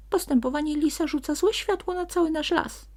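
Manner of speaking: high, loud sprite puffing after running; panting sprite. A narrator's reading. Sprite tired from running speaks to the donkey, high and puffing. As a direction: gasp it out, winded from the run. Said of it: "wysapał zmęczony biegiem".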